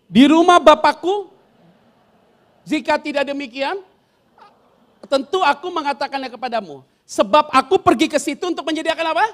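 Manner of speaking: loud, measured reading aloud; scripture read in unison; loud group Bible reading. Acted. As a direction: lead the church reading the verse. Reads scripture aloud with congregation.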